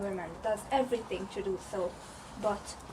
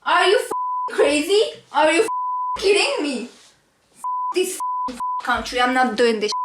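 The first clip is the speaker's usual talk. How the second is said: furious shouting; 8 semitones higher, 11 dB louder than usual. Acted. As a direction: scream it in disbelief. Angry customer rant.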